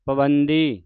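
ਪਵੰਦੀ stressed as taught; correct pronunciation. Shown as a demonstration. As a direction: model ਪਵੰਦੀ firmly. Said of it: "ਇਸ ਤਰ੍ਹਾਂ ਉਚਾਰਨ ਕਰਨਾ ਹੈ".

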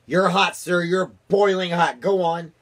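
high, loud, mounting encouragement; excited egging on. A narrator's reading. Chief spy egging on the president's guessing, excited and loud.

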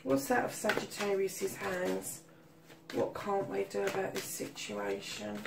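muttered aside. Real, not acted. Tarot reader muttering over the card meanings, softly. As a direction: murmur it under your breath as you think.

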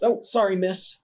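put-on high, light little boy's voice; a small village boy bumping into someone, apologizing; child voice.

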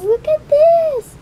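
high, loud show-off; look, it folds!; excited. Real, not acted. Showing off the folding fork.

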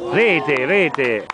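loud rising goal call; excited goal call. Real, not acted. Goal scored, commentator calls out.

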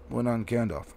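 ordinary delivery, nothing marked

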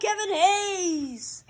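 high, drawn-out goal call; excited goal call. Real, not acted. Gamer celebrates Kevin Hayes scoring, excited goal call. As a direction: stretch the name out in celebration. Calls a goal in hockey game.

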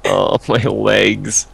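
drawn-out pained groan; slow groan. Pretend. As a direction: moan it out slowly. Groaning after injury in game.

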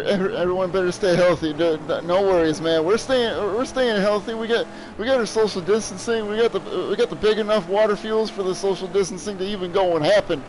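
normal talking voice

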